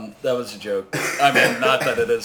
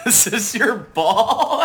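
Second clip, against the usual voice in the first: slow, laughing through the words; 8 semitones higher, 6 dB louder, slower than usual. Real, not acted. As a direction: giggle it out slowly. Joking while eating wings.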